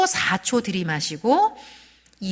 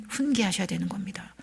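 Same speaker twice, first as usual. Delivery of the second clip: unvoiced hushed whisper; 7 dB quieter than usual. Real, not acted. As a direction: lean in and whisper the point. 설교 중 속삭임. (조용히 속삭이며)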